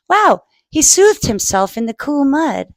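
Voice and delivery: ordinary delivery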